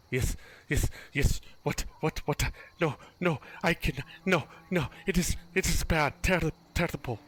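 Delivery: dazed, praying priest. Acted. Confused priest babbling to the visitors, distracted stammer. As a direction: stammer in broken, distracted bursts. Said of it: "he's still chanting and praying".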